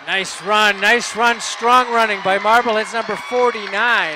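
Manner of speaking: loud, high-pitched praise of the run; thrilled football commentary; excited play-by-play. Real, not acted. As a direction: call it out over the crowd. Calling a strong run live.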